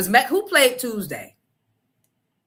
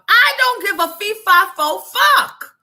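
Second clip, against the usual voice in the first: angry; 11 semitones higher, 8 dB louder than usual. Retold or imitated. Angry retelling, loud.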